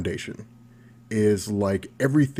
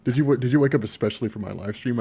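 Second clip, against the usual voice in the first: usual speaking voice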